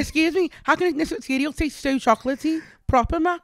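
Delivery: high, loud Scouse accent; exaggerated high-pitched Liverpool voice; hammed-up Scouse, pushed high and loud. Shown as a demonstration. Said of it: "Do your Liverpool accent".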